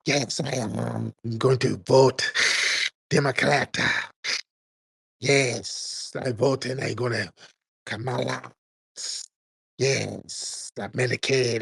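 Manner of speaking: mock whispering caller. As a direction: mimic the caller with long pauses and a put-on voice. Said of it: "He's like".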